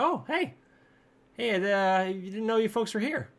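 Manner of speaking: high-pitched, badly faked Minnesota greeting; attempted Minnesota accent. Patty surprised by the visitors, attempted Minnesota accent. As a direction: try a high Minnesota lilt. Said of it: "can't do a Minnesota accent".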